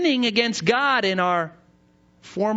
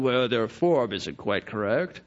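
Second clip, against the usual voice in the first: lower, softer prissy disapproval; mock judgmental; 10 semitones lower, 4 dB quieter than usual. Judging other worshippers, lower, softer and prim. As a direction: sniff at their form, lower and softer.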